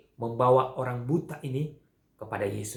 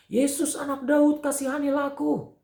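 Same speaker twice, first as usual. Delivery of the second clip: insistent shouting; 11 semitones higher than usual. Acted. Blind beggar calling harder as crowd hushes him, acted shouting. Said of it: "semakin dia berteriak memanggil nama Yesus".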